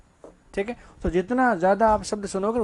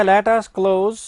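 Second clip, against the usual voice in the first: very slow, loud English words; slow stressed English; 6 dB louder, much slower than usual. Shown as a demonstration. Teacher slowly drilling English pronunciation. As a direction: drawl each word out for the class. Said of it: "अब उच्चारण क्या है उसका".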